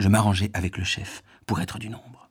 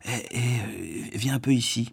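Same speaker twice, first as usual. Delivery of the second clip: voix doucereuse; 4 dB quieter than usual. Acted. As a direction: coax him over sweetly. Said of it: "d'une voix doucereuse". (voix doucereuse et lente)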